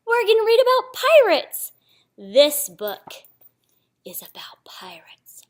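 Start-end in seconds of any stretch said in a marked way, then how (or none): 0.1-1.7 high, loud eager reveal; excited. Real, not acted. 4.0-5.4 slow hushed whisper. Real, not acted. Hushed reveal of book.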